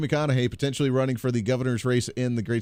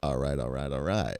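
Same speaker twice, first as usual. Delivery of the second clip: McConaughey catchphrase; 12 semitones lower, 6 dB quieter than usual. Retold or imitated. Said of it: "his slogan would be like".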